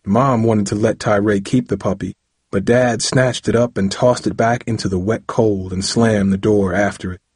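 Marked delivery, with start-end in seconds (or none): none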